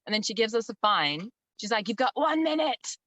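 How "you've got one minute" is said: high-pitched mock ultimatum; retold worked-up voice, pitched way up; policewoman going insane. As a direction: imitate an irate officer giving a final warning. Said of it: "going insane".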